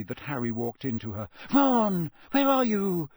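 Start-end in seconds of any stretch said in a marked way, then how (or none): 1.5-3.1 high, loud worried calls; anxious calling. Acted. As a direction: search the dark for him. Hermione searching in the dark.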